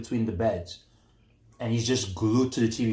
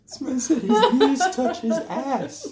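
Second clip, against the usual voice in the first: high and loud, laughing through the words; 6 dB louder than usual. Real, not acted. Friend retelling the fall, laughing high and loud. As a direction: crack up while you say it.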